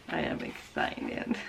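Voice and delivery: breathy whisper of real excitement. Real, not acted. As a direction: hush it like a secret thrill. Reading a gift wrapper, hushed.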